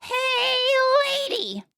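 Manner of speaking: high, drawn-out parrot squawk; talking parrot. Acted. Parrot calls to a lady walking by, high parrot voice. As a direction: stretch it out like a shrill talking parrot. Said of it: "The parrot says".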